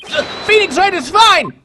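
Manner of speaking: high, loud, stammered-start yell. Acted. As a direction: shout it slowly so they can hear you. I can't hear you!.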